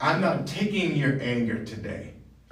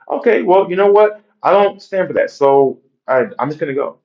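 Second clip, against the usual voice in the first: firm walkout; 5 semitones higher, 12 dB louder than usual. Acted. Acted reply to a cheating partner.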